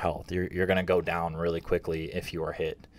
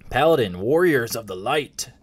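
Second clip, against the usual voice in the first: higher-pitched, drawn-out epic proclamation; grand heroic announcer; 3 semitones higher, 3 dB louder, slower than usual. Pretend. Host introducing paladins as warriors of light, grand proclamation. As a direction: herald the class like a mock-epic announcer.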